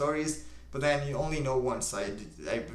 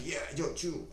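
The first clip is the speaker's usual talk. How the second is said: higher, softer imitation of a hyper YouTuber; mock hyper; 5 semitones higher, 5 dB quieter than usual. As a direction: lightly mimic an over-enthusiastic video host. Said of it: "super hyper all the time".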